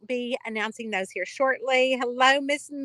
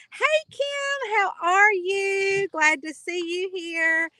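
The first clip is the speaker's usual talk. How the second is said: excited greeting; 8 semitones higher, 3 dB louder, slower than usual. Real, not acted. Greeting viewer Kim on livestream.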